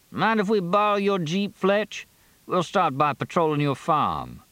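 high-pitched character voice for the sheriff; sheriff asking a favour. Acted. Sheriff asks to borrow Fletch's Jeep, voice pitched high. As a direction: pitch the request far above the narration.

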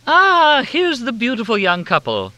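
very high, loud effusive greeting; gushing hostess. A narrator's reading. Helena greeting the group with high gushing warmth.

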